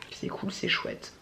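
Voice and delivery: softly, slowly to herself; quiet aside. Real, not acted. Vlogger musing to herself about filming time, quiet aside. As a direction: say it low and unhurried. Tries a silly rhyme to herself.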